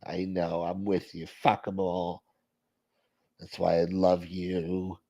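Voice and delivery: low, soft phone-skit voice; put-on soothing voice. Host playing a caller who sides with the other, put-on soothing. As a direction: agree quietly in a put-on low voice.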